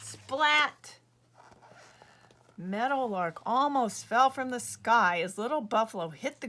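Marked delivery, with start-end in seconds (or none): 0.0-1.0 loud comic splat sound. Acted. Buffalo hitting the ground, loud comic splat. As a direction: smack the word like a fall.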